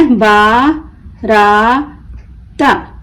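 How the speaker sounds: बारात very slow in three pieces, बा, रा, त; broken into syllables. Shown as a demonstration. Teacher breaking the word for learners, बारात as baa... raa... ta. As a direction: stretch and separate each part of बारात. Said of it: "तोड़ तोड़ कर पढ़ना सीखें".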